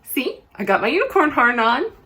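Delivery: usual speaking voice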